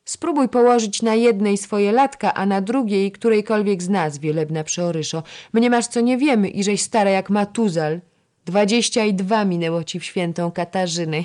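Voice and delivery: high, loud teasing jibe; taunting. A narrator's reading. Young lady-in-waiting mocking an older one's age, taunting. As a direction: needle her about being old.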